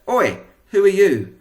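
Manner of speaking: a high, loud shouted Oi; a soldier bellowing a challenge. Acted. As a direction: shout it at the strangers. Macbeth challenging the witches.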